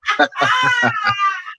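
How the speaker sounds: high wheezing laughter; shrieking laugh. Real, not acted. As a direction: laugh helplessly, high and loud. Cracking up at the street cry.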